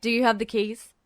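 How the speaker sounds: businesslike request; escort asking. Acted. Escort voiced.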